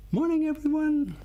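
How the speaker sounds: high bright classroom hello; cheery teacher greeting. Shown as a demonstration.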